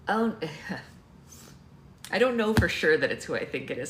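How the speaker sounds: plain reading voice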